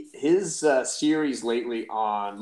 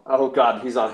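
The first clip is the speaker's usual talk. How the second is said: ordinary talk, nothing marked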